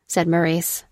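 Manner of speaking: ordinary delivery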